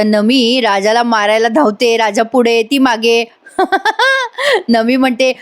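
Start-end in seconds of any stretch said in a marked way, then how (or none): 3.6-4.6 high burst of laughter that falls; real laugh. Real, not acted. Narrator laughs.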